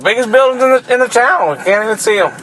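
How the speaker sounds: squeaky baffled sightseer; high mock voice. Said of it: "They're like".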